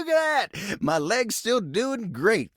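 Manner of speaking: high, excited Southern holler; thrilled and high-pitched; delighted exclamation. Acted. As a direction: show off with high-pitched glee. Delighted that his leg still works.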